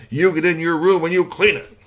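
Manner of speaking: high, loud commanding dad; stern father order. Acted. Father ordering his son to clean, high and loud. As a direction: send him to his room firmly.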